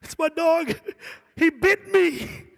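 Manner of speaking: high, loud, slow whiny wail; mock sobbing caller. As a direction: wail about the dog bite. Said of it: "The guy's crying".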